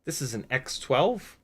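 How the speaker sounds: usual speaking voice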